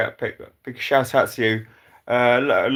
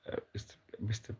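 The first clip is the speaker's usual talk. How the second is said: whispered, fast, fumbling; 16 dB quieter, faster than usual. Real, not acted. Stumbling over a viewer's name, whispered. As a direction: breathe the name under your breath.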